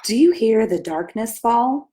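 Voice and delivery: ordinary delivery, nothing marked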